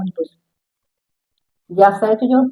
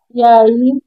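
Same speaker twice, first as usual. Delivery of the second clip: ordinary talk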